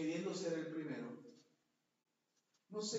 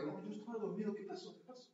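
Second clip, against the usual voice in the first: feigned innocence; 3 semitones higher, 6 dB quieter than usual. Pretend.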